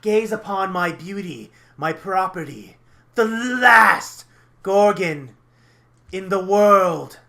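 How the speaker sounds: loud, high, grandiose proclamation; theatrical villain showing off his prize; villain monologuing. Acted. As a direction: declaim slowly, stretching the big words. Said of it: "he's gonna start monologuing".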